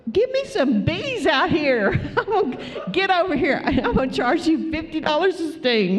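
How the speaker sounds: high-pitched mock hustler, laughing; playful, high put-on voice breaking into laughs; joking pitch. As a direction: ham it up as a comic seller touting for business. Joking skit, then "just kidding.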